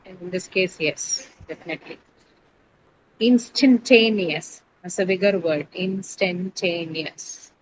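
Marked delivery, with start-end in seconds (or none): 3.2-4.6 "Instantaneous" said slowly and a bit louder, syllable by syllable; slow model word. Shown as a demonstration. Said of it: "Which is the stress syllable?". 5.7-7.5 "Instantaneous" stretched very slowly, softer; very slow model word. Shown as a demonstration. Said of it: "Which is the stress syllable?".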